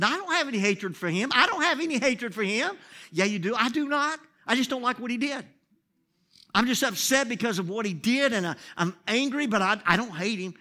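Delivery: loud, high protest, half laughing; arguing with God. Retold or imitated. Pastor protesting to God, loud and high. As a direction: insist hotly that it isn't true. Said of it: "I argued with God".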